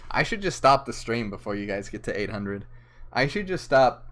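ordinary talk

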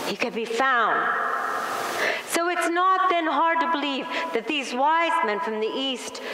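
usual speaking voice

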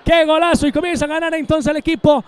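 high, loud goal call; breakneck goal commentary. Real, not acted. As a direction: scream the goal, stretched and soaring. Goal scored, commentator erupts.

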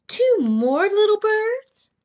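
high, loud, drawn-out question; delighted surprise. Acted. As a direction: stretch it out in acted amazement.

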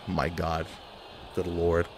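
very quiet and low, deflated; hushed, low disbelief; stunned murmur. Real, not acted. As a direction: say it under your breath. Deflated after the move.

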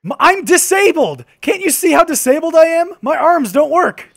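loud, high-pitched hammed-up whine; mock indignant protest. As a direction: protest up high, playing the wronged victim. Mock protest in bickering over hands.

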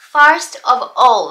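"first of all" with the three words kept apart; unlinked pronunciation. Shown as a demonstration. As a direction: sound out "first", "of", "all" without linking. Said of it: "なんて普通の日常会話で". (「first of all」をつなげずに区切って発音)

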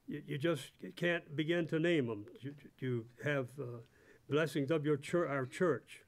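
ordinary talk, nothing marked

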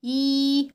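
a held, steady اي; long ii sound. Shown as a demonstration. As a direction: draw اي out like slime. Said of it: "بيطول صوته ازاي".

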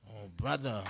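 low, soft, embarrassed "Oh, brother" with a chuckle; sheepish clerk. Retold or imitated. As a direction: mumble the excuse, a little caught out. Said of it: "That's all they could do".

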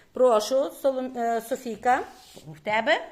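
ordinary talk

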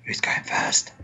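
soft unvoiced whisper. Real, not acted. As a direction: whisper it off-mic. Whispered aside after goodbye.

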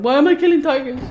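loud, shifted mock wail; retold yelled question. Quoting her own panicked yell at the tigers, loud. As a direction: cry it out in mock despair. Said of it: "screaming, going".

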